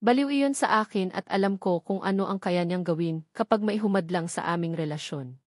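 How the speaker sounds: usual speaking voice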